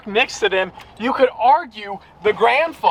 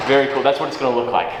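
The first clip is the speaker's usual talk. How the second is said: normal talking voice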